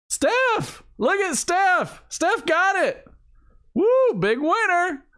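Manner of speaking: high, slow excited cheer; drawn-out delighted cheering; cheering winner. Real, not acted.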